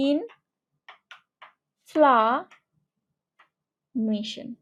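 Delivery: ইনফ্লামেশন stretched out syllable by syllable; syllable-split ইনফ্লামেশন; slow syllables. Shown as a demonstration. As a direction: draw out ইনফ্লামেশন for the class. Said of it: "এই তিনটা syllable ভাগ করতে পারো".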